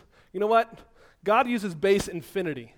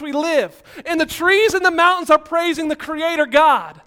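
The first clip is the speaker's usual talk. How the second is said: higher and louder, breathless with excitement; fired-up preaching; 7 semitones higher, 7 dB louder than usual. Real, not acted. Preacher still leaping before the church, fired-up and loud. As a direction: preach it while still bouncing.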